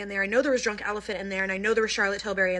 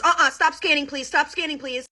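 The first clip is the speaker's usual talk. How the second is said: higher, louder firm stop order; firm mom; 6 semitones higher, 3 dB louder than usual. Retold or imitated. Mom stopping the cashier at the register, firm and sharp. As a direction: cut the cashier off firmly. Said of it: "Literally just like that".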